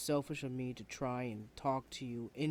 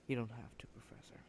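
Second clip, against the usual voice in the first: low hushed whisper; 3 semitones lower, 9 dB quieter than usual. Acted. Student whispering to the professor, hushed. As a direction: murmur it close to his ear.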